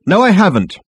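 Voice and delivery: high, loud, firm refusal; firmer denial. A narrator's reading. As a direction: put your foot down. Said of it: "be a little stronger on the subject".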